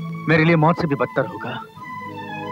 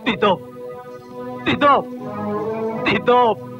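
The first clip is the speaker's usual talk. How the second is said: calling out; 6 semitones higher, much slower than usual. Acted. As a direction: shout the name, rising urgency. Brother calling out his name.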